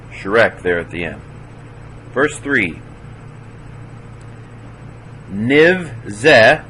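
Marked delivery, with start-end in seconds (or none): 5.3-6.6 "Niv ze" with "Niv" shut firmly on v; higher, deliberate "Niv", then a separate "ze"; closed syllable. Shown as a demonstration. Teacher showing a silent schwa, "Niv" closed off. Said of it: "closes a syllable".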